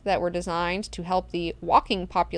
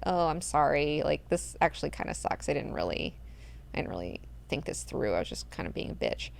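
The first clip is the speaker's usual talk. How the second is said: hushed, much lower mock apology; sheepish whisper; 4 semitones lower, 9 dB quieter than usual. Siona admits her mistake to Duncan, whispered. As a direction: mutter it sheepishly under your breath.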